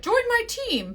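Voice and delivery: high-pitched sing-out invitation, imitated; mock recruiter. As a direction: chirp it like a pushy recruiter. Mimics a pushy team recruiter.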